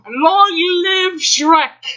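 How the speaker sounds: very slow, high, loud cheer; triumphant shout. Acted. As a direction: hail the ogre king.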